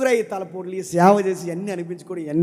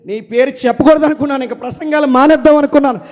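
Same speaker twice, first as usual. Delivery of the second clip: higher, louder anguished prophet; Jeremiah's lament; 9 semitones higher, 13 dB louder than usual. Acted. Prophet telling the Lord he will stop preaching, anguished. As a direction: cry it out as the weary prophet. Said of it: "యెరెమయా ఏమంటున్నాడంటే".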